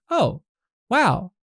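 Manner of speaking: high, loud, exaggerated soothing; mock sweet. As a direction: play the overly calm partner. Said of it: "Or you can respond with".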